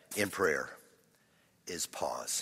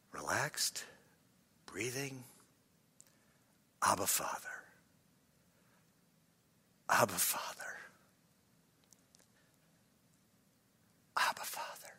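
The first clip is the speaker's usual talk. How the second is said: low, hushed, fading into a whisper; whispered prayer; 3 semitones lower, 9 dB quieter than usual. Shown as a demonstration. Preacher showing what to whisper to God, hushed whisper. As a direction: breathe the prayer softly, long pauses between. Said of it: "I want you to whisper".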